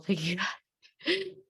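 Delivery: a laugh breaking through, getting emotional; teary laughter. Real, not acted. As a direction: laugh through rising tears.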